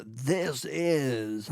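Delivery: drawn-out mock announcer intro; mock show opener. Co-host quoting a banned show intro, mock announcer. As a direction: stretch it out like a cheesy show opening. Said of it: "And he said, "Don't start the show with".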